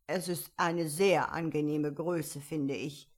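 restrained, emphatic irritation; angry. A narrator's reading. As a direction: take offence as the caterpillar. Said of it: "sagte die Raupe zornig".

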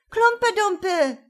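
put-on high, loud children's shout. A narrator's reading. Other children shouting the rival story, loud high call. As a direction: yell it back like rival children. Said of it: "schrien andere".

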